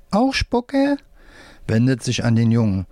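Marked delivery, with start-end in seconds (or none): 0.1-1.0 very high puppet squeak; left-hand puppet. A narrator's reading. Left hand questions the boy's spit, squeaky puppet voice. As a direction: ask in a tiny, very high puppet voice. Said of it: "Wolfram mit der linken Hand".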